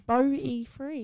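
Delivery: BOLTY FREE drawn out slowly; slow repeat. Real, not acted. Correcting a friend who misheard, "Bolty free" said slowly.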